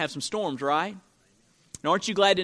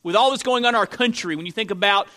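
raised, insistent pulpit voice; higher, emphatic sermon delivery; forceful preaching; 5 semitones higher, 5 dB louder than usual. Acted. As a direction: preach with rising conviction, punching key words.

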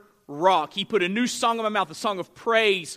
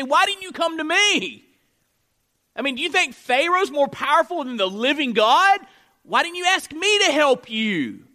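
angry God; 6 semitones higher, 6 dB louder than usual. Acted. God voiced angry at Israel.

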